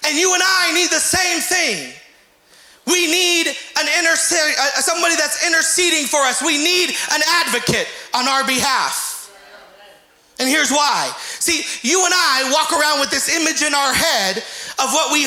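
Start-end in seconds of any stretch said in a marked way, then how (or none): 0.0-9.3 slow, emphatic preaching cadence. Acted. Pastor urging the church, slow emphatic preaching. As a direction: drive each phrase home slowly from the pulpit.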